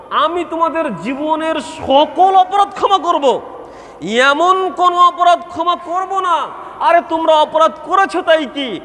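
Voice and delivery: high, ringing sermon delivery; impassioned preaching. Acted. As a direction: proclaim it to the congregation, pitched high. Preaches in God's voice.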